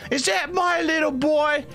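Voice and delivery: very high, scolding mama voice; guilt-trip your son in a high, put-on voice; kobold mother. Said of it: "my Australian mother?".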